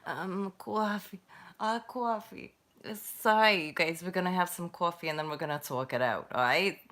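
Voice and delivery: put-on New York talk; New York accent. Streamer jokes with viewers about having coffee, New York accent. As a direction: do a broad New Yorker accent. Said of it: "this is from the New York".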